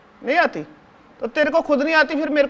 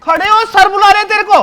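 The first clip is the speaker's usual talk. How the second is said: much higher, louder bossy shout; loud call; 8 semitones higher, 7 dB louder than usual. Acted. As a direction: shout at the boy to stand up. Calling the boy to teacher.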